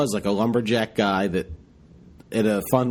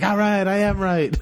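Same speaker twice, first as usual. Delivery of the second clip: high-pitched mock vindication; retold triumphant relief; 9 semitones higher than usual. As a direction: celebrate being proved right. Retold relief at being confirmed.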